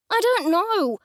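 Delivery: sharp, high, loud snap; snapping. A narrator's reading. Fed-up suspect snaps at the detective, angry snap. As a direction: bite the answer off. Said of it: "I snapped".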